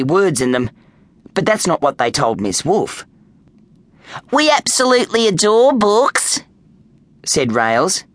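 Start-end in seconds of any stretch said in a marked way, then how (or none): 4.3-6.4 high, sweet schoolgirl voice; adorable act. A narrator's reading. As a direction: lay on the innocence in a high voice. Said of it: "She smiled adorably".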